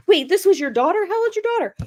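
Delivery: very high-pitched mock astonishment; imitated disbelief. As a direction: question like a stunned dispatcher, pitch leaping up. Dispatcher's shocked questions retold.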